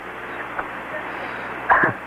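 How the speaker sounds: breathless high-pitched giggle; a squeaky, airy laugh. Real, not acted. Guest giggles at his own fire extinguisher joke, high and airy.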